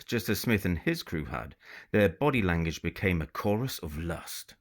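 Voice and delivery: plain reading voice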